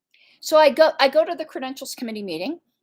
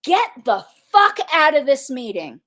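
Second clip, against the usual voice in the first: louder, snapped-out quote of a screaming chair; mock yell, louder; retold scream; 3 semitones higher, 6 dB louder than usual. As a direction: throw her yelled order back at them. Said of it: "screams at me".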